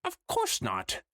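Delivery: high, hammy Trottingham spirit voice; the ghost covering itself, pitched high; bad Trottingham accent. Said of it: "the bad Trottingham accent".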